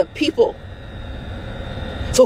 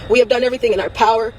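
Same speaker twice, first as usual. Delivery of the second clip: ordinary talk, nothing marked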